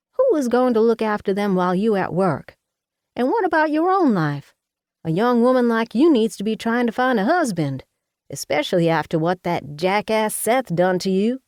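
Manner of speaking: high, loud fussing mother voice; a bossy Southern mama fussing over her daughter. Acted.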